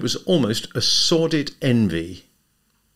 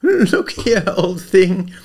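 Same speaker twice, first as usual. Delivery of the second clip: plaintive; 10 semitones higher, 4 dB louder, much slower than usual. A narrator's reading. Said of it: "he said plaintively".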